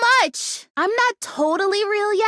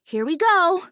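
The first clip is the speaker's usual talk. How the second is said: ordinary talk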